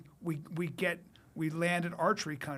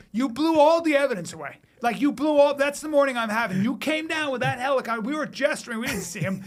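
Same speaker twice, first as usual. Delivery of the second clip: higher, louder, fed-up detective ranting; exasperated cop; 6 semitones higher, 6 dB louder than usual. Retold or imitated. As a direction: rant like a furious detective whose scene got wrecked. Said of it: "the guy goes".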